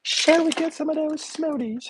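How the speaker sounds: high, slow mock-British voice; muddled British accent. Inviting viewers to smoothies in a put-on British accent. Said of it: "is that from the U K".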